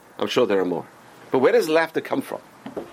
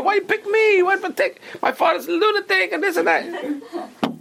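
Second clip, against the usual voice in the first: high-pitched mock whine, climbing higher; exaggerated whiny pleading, pitched way up; most whiny voice; 13 semitones higher than usual. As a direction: whine it like a hammed-up, put-upon victim. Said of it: "you put on the most whiny voice".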